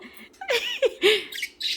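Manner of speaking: a long loud real laugh; laughter. Real, not acted. Vlogger laughing as she wraps up the bird video, loud laugh. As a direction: let the laugh roll out.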